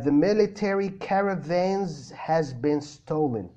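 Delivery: slow full American sentence; American accent. Shown as a demonstration. Teacher's final American read of the viewer's sentence. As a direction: deliver "the military caravans has been stolen" slowly in American. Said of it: "بالأكسنت ... الأمريكان".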